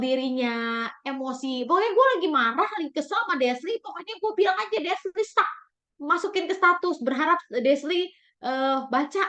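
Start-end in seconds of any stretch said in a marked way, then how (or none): 1.6-9.2 mock upset teenager, quick and high; angry teen. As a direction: voice the annoyed teen blurting it out. Teen voiced venting anger. (remaja kesal yang ditirukan, cepat dan tinggi)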